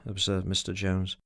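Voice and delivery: usual speaking voice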